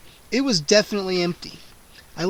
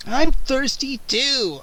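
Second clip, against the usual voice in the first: booming; 7 semitones higher, 4 dB louder, slower than usual. A narrator's reading. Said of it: "a voice boomed".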